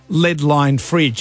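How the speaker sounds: slow, spelled-out label reading; drawn-out mock reading of the sign; mock announcement. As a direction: read it out slowly like a caption. Mocking the film's close-up label.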